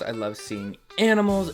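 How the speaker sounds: ordinary delivery, nothing marked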